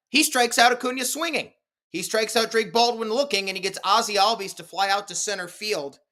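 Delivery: high-pitched, rapid-fire list of outs; excited highlight call, pitched well up; excited play recap. Retold or imitated. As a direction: reel off each out high, with mounting triumph. Excited recap of escaping jam.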